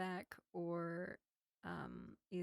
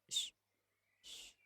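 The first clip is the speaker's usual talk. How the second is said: hushing shush. Acted. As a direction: hush the listener to listen out. Vampire hushes the elf.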